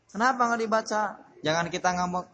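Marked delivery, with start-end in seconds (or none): 0.1-1.0 put-on questioning child. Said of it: "anak yang nanya-nanya".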